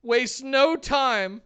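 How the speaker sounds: high, loud, relishing recap; excited hype. Real, not acted. Co-host recounting a wild death match, excited hype. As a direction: punch out each phrase with rising excitement.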